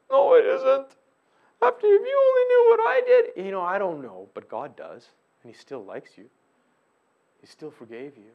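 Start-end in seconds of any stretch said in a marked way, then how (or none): 0.1-3.4 high, loud anguished protest; despairing friend. Retold or imitated. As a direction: play a guilt-stricken friend.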